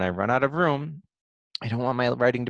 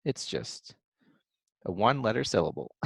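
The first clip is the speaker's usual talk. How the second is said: normal talking voice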